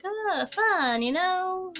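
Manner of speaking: high, ironic "oh, fun"; wry sarcasm about the storm; sarcastic. Acted. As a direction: say it like it's anything but fun. Tornado warnings at bingo.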